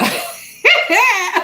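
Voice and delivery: high, loud laugh; sudden high-pitched burst of laughter. Real, not acted. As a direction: crack up mid-story. Laughs while trying to remember.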